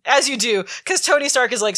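normal talking voice

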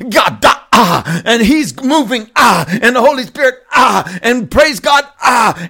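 high, loud hammed-up preacher; shouting pastor imitation. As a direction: parody a pastor gasping "ah" after each phrase. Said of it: "starts shouting some things with a ha after everything".

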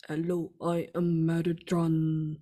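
slow, drawn-out mock robot; robotic voice. Speaker mimicking people who claim to channel Metatron, robotic voice. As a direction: imitate a robot introducing itself, slowly. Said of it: "it's like robotic voice".

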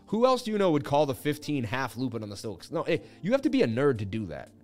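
ordinary delivery

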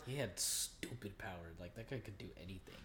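hushed whisper, quick at the end. Real, not acted. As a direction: whisper it in awe. Whispered aside about power.

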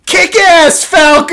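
high, loud cheer; cheerleader shout. Retold or imitated. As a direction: yell it like a cheerleader from the sideline. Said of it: "she just goes".

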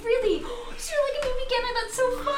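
high, gasping delight; gushing. Acted. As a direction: gasp and gush with delight. Gushing NPC reaction.

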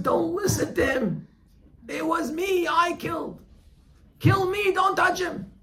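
desperate shout. Acted. Accused friend shouts to the executioner to kill him instead, desperate scream. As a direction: yell over the other friend to claim the blame. Both friends demand to be killed.

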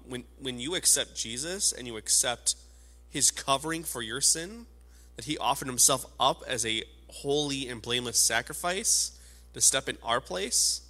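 usual speaking voice

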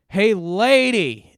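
slow, high, loud heckle; mock irate bystander, drawn out; imitated angry shout. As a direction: holler at her from down the line. Angry man in line confronts customer.

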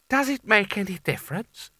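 high, anxious question; fearful passenger. A narrator's reading. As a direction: ask in a high voice, clinging on. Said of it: "lying flat on his horse's neck with his eyes shut".

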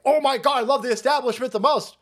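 high, sarcastic fawning; hammed-up bootlicker's adoration; mock gushing. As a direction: gush with over-the-top devotion. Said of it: "all of these people are like".

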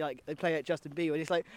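normal talking voice

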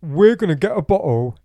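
loud, high, fast laddish rant; mocking drunk Jeevan. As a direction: ham up a pushy drunk mate. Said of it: "I didn't say it like that".

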